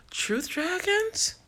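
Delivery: high, slow, delighted reading of the name. Real, not acted. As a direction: stretch the name out, thrilled by it. Reads out a name from comments.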